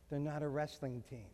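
soft, low gentle correction; gentle reminder. Retold or imitated. Wife gently reminding her husband, softly. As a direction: ease him down softly. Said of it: "puts her hand very gently on my shoulder".

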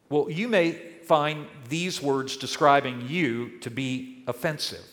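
ordinary delivery

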